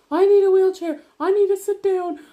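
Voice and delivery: high, loud reenacted plea; frantic demand. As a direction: act out a desperate woman in labour calling for help. Acting out demands in labour.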